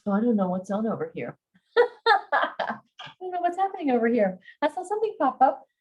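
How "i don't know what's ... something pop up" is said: high-pitched, giggly surprise; laughing. Real, not acted. Reader distracted by something popping up, laughing. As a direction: talk through a real laugh, pitched way up.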